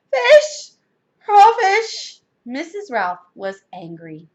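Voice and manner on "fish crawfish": high, loud shriek; horrified scream. A narrator's reading. Missus Ralph tasting fish, screaming. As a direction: scream each word in revulsion. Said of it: "she screamed".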